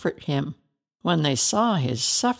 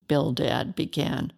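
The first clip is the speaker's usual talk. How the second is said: ordinary delivery, nothing marked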